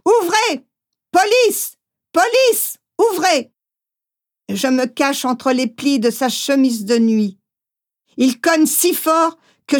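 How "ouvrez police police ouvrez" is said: higher, barked commands through the door; police calling at the door. Acted. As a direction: pound the door and call out orders. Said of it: "Les appels se poursuivent".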